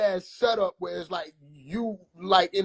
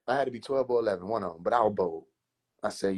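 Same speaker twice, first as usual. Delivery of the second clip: ordinary delivery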